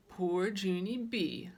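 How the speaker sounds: slow, drawn-out teasing pity. Acted. Mother teasing her jealous daughter, slow mock pity. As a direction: tease her gently, stretching it out. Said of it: "very teasing".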